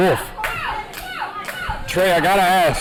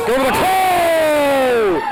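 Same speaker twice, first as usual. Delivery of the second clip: excited call; 6 semitones higher, much slower than usual. Real, not acted. Commentator calling a pin.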